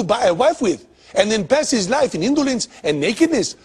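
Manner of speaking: hammy put-on Indian accent; bad Indian accent. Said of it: "my bullshit Indian accent".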